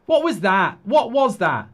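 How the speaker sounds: loud, incredulous yell; furious fan yelling at a reckless shot; outraged shout. Real, not acted. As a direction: bark it in disbelief at the player.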